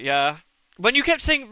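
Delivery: ordinary delivery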